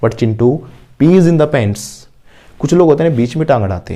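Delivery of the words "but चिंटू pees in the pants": very slow, word by word, for students to repeat; slow model sentence. Shown as a demonstration. Teacher modelling an answer for the class, very slowly. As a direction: spell each word out slowly like a dictation.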